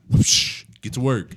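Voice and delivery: a sharp unvoiced whoosh, then a brisk order; imitated whoosh, then a curt command; whoosh sound effect. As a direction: swoosh the air, then bark get to work. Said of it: "Just hitting me with the".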